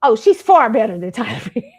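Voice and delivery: mock indignant. Imitating classmates defending her against the comparison, emphatic. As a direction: insist with laughing pride.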